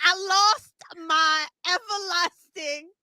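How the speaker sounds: normal talking voice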